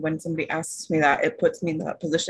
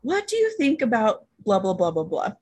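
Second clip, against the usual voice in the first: curious client; 6 semitones higher than usual. Retold or imitated. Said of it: "she goes".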